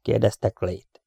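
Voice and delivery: plain reading voice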